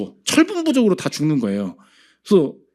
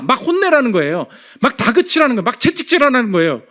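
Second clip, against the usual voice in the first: high, loud exhortation from the pulpit; impassioned preaching; 8 semitones higher, 5 dB louder than usual. Real, not acted. Pastor challenging the congregation not to stop, impassioned preaching. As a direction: press the congregation hard with raised pitch.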